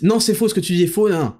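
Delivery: quick, high-pitched protest; acted instant objection. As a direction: blurt out a mock denial, fast and high. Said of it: "Si vous dites tout de suite".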